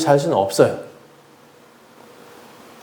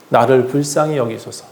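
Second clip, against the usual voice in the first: ordinary talk, nothing marked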